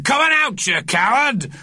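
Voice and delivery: high, blustering challenge; shouting old man. A narrator's reading. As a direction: dare the enemy to show itself.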